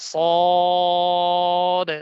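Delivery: সদ with a loud, long-held round "o"; heavy, drawn-out. Shown as a demonstration. Teacher modelling for the class, long heavy সদ. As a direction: hold সদ thick and steady, then close on the d. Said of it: "এরকম হবে".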